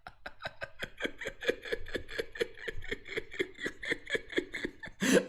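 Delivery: real laughter. Real, not acted. Laughter practice breaks into laughs.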